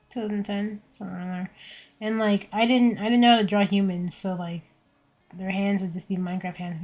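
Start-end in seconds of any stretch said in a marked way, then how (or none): none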